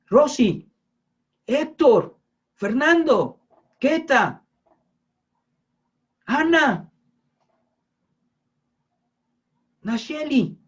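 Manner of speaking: God summoning someone directly by name; calling by name. Acted. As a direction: call out each person separately, with pauses.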